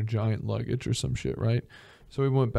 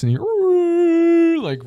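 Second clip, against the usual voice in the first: eerie drawn-out sound; 6 dB louder, much slower than usual. Pretend. Imitating clicks underwater.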